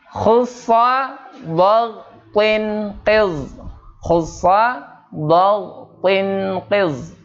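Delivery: drawn-out Arabic mnemonic, syllable by syllable; slow spelled-out letters. Shown as a demonstration. Quran teacher drilling students on letter sounds, slow and deliberate. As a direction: model each sound slowly for the class. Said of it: "Pertama adalah kha".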